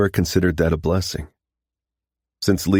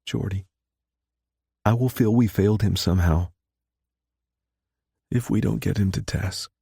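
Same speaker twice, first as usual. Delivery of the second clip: tearful; 5 semitones higher than usual. A narrator's reading. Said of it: "the tears glistening in those hazel eyes".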